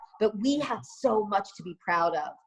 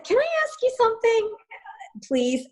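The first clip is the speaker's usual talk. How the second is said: much higher, quicker, sugary polite question; sweet high polite ask; 14 semitones higher than usual. Retold or imitated. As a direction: put on a sing-song polite voice to ask a stranger. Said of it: "And I said".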